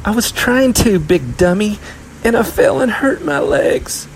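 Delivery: high-pitched, hurt little-sister protest; aggrieved girl's whine; whiny younger girl. A narrator's reading. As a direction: complain like an upset child.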